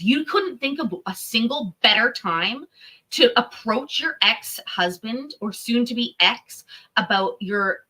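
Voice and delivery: ordinary talk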